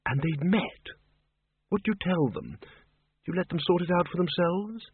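soft, hesitant character voice; Windle Poons, tentative. Acted. Windle asks the librarian about wolf people meeting, tentative. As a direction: feel your way carefully through an awkward hypothetical.

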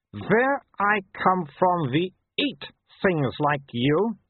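slow, high, deliberate threat; menacing she-wolf. A narrator's reading. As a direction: warn the small dog off, word by word.

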